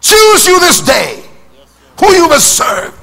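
high, slow, booming proclamation; Joshua's shout. Retold or imitated. Joshua commanding three million people, booming shout. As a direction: call it out like Joshua to the nation. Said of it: "Let's say like Joshua".

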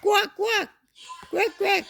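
high squeaky quack, pitched well up; duck quack. Shown as a demonstration. Mother showing her child how a duck quacks, high. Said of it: "어떻게 울어봐?".

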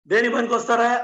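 ordinary talk, nothing marked

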